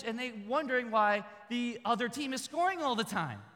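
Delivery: ordinary talk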